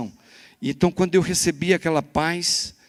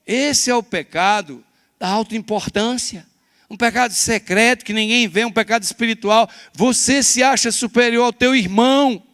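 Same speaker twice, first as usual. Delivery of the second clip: loud, high preaching voice, slowing on key words; full-force pulpit sermon; fiery preaching; 10 semitones higher, 9 dB louder than usual. Acted. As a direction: preach it out to the whole church.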